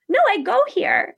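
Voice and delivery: high-pitched matter-of-course reply, imitating Elle; as if obvious. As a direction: say it as if it's so obvious. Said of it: "as if it's, like, so obvious".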